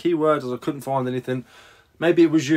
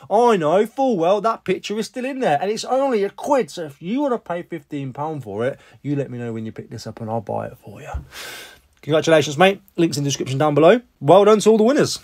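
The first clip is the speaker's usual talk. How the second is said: normal talking voice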